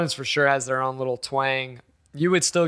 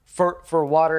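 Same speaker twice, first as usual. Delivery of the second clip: usual speaking voice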